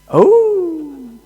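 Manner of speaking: high, loud startled "Oh"; jolted by a sudden realisation; sudden surprise. Real, not acted. Sudden realisation mid-story.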